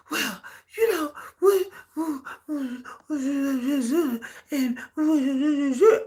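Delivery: imitating a teary complaint; hammed-up crying girl; mock whimper. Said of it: "They up here".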